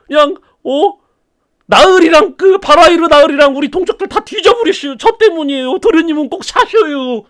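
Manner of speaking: put-on dead servant confessing to young master; wailing ghost servant. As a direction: plead for forgiveness.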